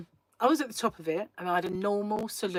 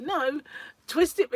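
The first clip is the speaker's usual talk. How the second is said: put-on child pleading; 10 semitones higher, 6 dB louder than usual. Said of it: "He was like".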